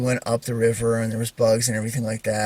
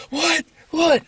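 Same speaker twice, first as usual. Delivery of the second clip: very high-pitched yelp of confusion; squeaky mock-panicked questioning; panicked brother; 5 dB louder than usual. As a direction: imitate a frantic kid, pitch shooting up. Voices his panicking little brother.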